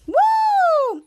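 a high, loud whoop of triumph; cheer. Real, not acted. Finishing the story, a high loud whoop of joy. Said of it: "cheering after the story ends".

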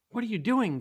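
high, loud, startled mom; sharp, high outburst; exclaimed. A narrator's reading. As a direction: exclaim it like a mother catching her son. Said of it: "Guy's mom exclaimed".

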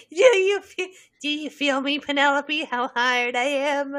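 silly put-on character voice; Percy Weasley. Co-host as Percy talking to Penelope, put-on voice. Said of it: "It's Percy Weasley talking to Penelope".

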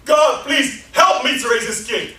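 a loud, drawn-out plea; desperate shouted prayer. Acted. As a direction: cry out to God, slow and loud. Preacher voicing a desperate prayer.

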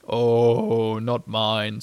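low, self-satisfied reply; proud. A narrator's reading. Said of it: "said Eeyore proudly".

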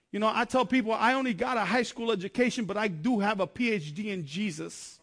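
fervent testimony preaching to the congregation. Acted. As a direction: drive it home like a pulpit sermon. Preaching to the audience.

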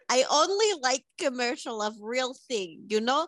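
deadpan put-on Eastern European accent; Svetlana accent. Svetlana greets the podcast hosts in a thick put-on accent. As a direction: play Svetlana, blunt foreign guest.

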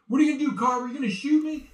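high, intense Travolta line read; Travolta voice match. Acted. Doing scratch for Travolta in the booth, voice match. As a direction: spit out the threat like Travolta. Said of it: "that sounds just like him".

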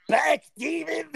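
startled shout. Real, not acted. Ghost hunting scare, shouted.

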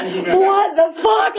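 high, slow, half-laughing "what the"; stunned and amused at the weirdos; shocked disbelief. Retold or imitated. Retelling her first AA meeting, high laughing disbelief.

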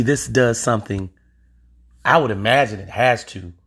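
usual speaking voice

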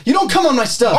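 angry warning. Acted. Acted shouting match.

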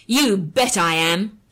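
loud, slow defiant call. A narrator's reading. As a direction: call out to the empty room. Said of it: "she called out".

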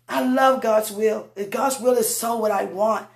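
normal talking voice

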